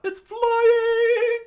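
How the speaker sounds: very high, loud startled scream. A narrator's reading. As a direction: scream it in alarm. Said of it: "screamed out".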